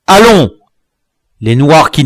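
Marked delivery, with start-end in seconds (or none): 0.1-0.5 high, loud one-word order; cried command. A narrator's reading. Dwarf signalling men to throw the captive, cried command. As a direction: shout the signal to go. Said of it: "Le nain s'écria".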